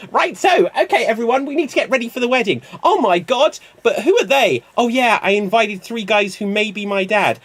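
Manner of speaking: high, loud, rushed film parody; excited wedding flurry. Acted. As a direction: gush in hammed-up panic and excitement. Hammed-up wedding scene, several figures.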